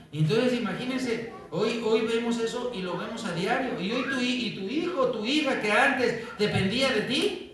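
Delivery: mounting preacher's fervour; rising preaching. Acted. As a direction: preach it up, pressing the point. Preacher raising pitch on children.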